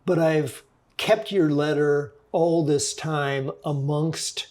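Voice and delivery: ordinary talk, nothing marked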